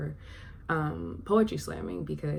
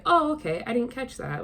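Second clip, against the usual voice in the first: higher, louder lightly acted "oh, I see"; pitched up, mock realization; imagined reader's realization; 7 semitones higher, 5 dB louder than usual. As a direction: act out a reader catching on. Acting out a reader rereading.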